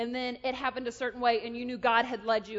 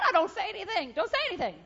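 mock-stern interruption, raised and louder; God cutting in; 6 semitones higher, 6 dB louder, faster than usual. God cuts off a chattering prayer, loud insistence. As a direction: cut in sharply with a high, loud command. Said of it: "he said".

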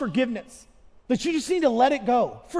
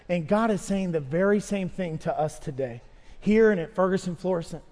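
ordinary delivery

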